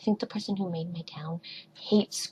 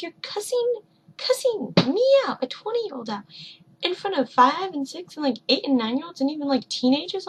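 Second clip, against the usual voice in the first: higher, outraged retelling of the comeback; indignant retort; 6 semitones higher, 4 dB louder than usual. Retold or imitated. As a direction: replay the outrage, pitched up. Retold retort to yelling parent rep.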